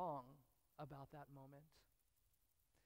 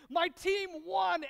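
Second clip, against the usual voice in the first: mock fan elation; 9 semitones higher, 8 dB louder than usual. Preacher imitating a sports fan's joy, high and loud. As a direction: cheer it like your team just won the title. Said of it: "where you're like".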